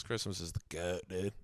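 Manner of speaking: low, laid-back bro; lightly voiced heavy bro. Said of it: "This is a new, like, voice I'm doing".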